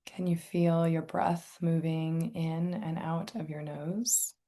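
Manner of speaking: calm, unhurried meditation guide, long pauses; guided meditation. Real, not acted. Inviting listeners to tune into senses and breath, guided meditation. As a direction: softly guide listeners into relaxation.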